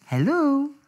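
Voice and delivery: high-pitched nervous phone greeting; a small scared hello; anxious hello. Retold or imitated. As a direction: caller waiting for the emergency line. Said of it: "et machin et tout".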